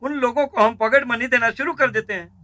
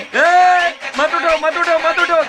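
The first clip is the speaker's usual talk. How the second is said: high, loud, boisterous urging; excited shout; 8 semitones higher, 4 dB louder than usual. Acted. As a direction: whoop them onto their feet to dance. गाना बजने पर सबको नचाना. (जोश में ऊँची आवाज़ में पुकारते हुए)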